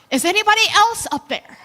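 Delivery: loud, high panicked call; panicked shout. Retold or imitated. Man dangling from a branch shouts up, panicked. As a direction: yell up in a panic for someone else. Punchline, man calls out again.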